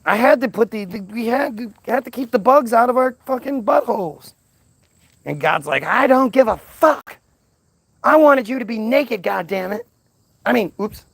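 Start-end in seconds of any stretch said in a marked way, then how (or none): 0.0-4.3 pleading excuse. Retold or imitated. Adam pleading excuses to God. 5.8-9.8 angry God. Retold or imitated. God scolds Adam and Eve, high angry outburst.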